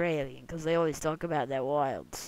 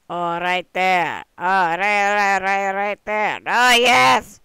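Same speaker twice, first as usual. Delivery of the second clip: loud, drawn-out cries of delight; excited yelling; 3 semitones higher, 17 dB louder, slower than usual. Real, not acted. Gamer shouting with joy at the target, loud. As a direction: yell as it finally lands right.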